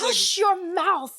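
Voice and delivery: high, slow, drawn-out reprimand; stretched, high-pitched telling-off; scolding hush. Retold or imitated. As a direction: shut someone up like a stern mama. Retold scolding of boyfriend.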